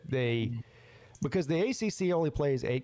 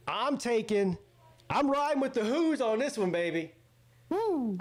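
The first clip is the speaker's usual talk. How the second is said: excited; 10 semitones higher than usual. Real, not acted. Hyping his pick, the Hoos.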